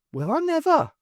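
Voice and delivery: high, prim exclamation; prim surprise. Acted. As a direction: gasp it out primly. Maisie surprised.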